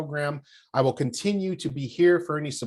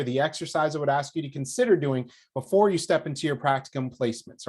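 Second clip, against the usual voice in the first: ordinary delivery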